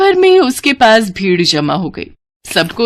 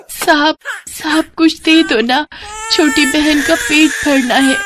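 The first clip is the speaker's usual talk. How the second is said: put-on higher little girl's begging voice; 8 semitones higher than usual. Little girl begging passers-by for food, higher voice.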